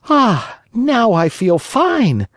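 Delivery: high, slow contented sigh; satisfied Pinocchio. Acted. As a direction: relish being full at last.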